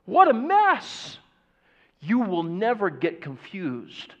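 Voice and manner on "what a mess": high, slow, put-on groan of despair; mock exasperation. Mocking the news muddle for the congregation, high slow exasperation. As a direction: throw up your hands at the muddle.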